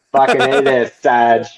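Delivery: imitated Boston soldier, r dropped in Sarge; Boston accent. Kid from the platoon addressing his sergeant, Boston accent. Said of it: "it just sounded so great coming from him".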